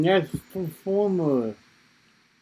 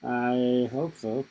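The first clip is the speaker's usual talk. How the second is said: slow, hesitant married-man answer; 4 semitones lower than usual. Real, not acted. Host answers comic on being in control, slow and doubtful. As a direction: draw out a doubtful hope. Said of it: "spoken like a true married man".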